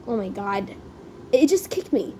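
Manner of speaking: ordinary delivery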